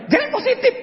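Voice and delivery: a hint of a stern wife; wife warning. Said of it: "istri saya bilang".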